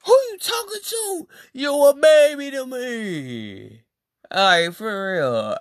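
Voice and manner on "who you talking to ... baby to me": much higher, slowed taunt; mock indignant. Retelling his comeback when she called him fake, high mocking taunt. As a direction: squeal it like you're talking down to a toddler. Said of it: "And I'm sitting there like".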